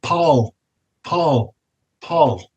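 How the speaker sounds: softly imitated woman calling out; soft, spaced-out calls, like a distant voice; woman's ghostly call. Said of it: "you can hear a woman's voice go".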